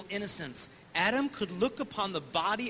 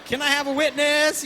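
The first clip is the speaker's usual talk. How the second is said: high, loud gospel-style call-out; shouting back at the preacher, high and loud; cheering church crowd; 7 semitones higher, 11 dB louder than usual. Retold or imitated. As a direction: whoop it up like a revival congregation. Girls cheering the priest on.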